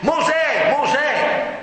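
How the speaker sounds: high-pitched calling out; acted cry. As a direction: call the name like God from the bush. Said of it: "gridò a lui dal roveto".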